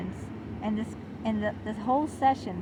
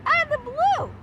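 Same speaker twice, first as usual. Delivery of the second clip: much higher, louder "out of the blue"; peak indignation; 8 dB louder than usual. Real, not acted. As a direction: top it with a near squeal of outrage. Repeat, more indignant.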